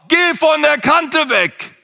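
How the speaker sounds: a mother's voice yelling in his ear; shouted warning. Retold or imitated. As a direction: shout the warning slowly from above. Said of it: "die schrie mir ins Ohr".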